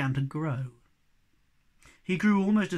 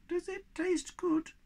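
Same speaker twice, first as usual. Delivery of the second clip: much higher, slow timid squeak; squeaky little creature; 13 semitones higher, slower than usual. Acted. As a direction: ask it like a tiny shy creature. Tiny creature voice, curious.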